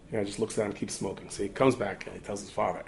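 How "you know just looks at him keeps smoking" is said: normal talking voice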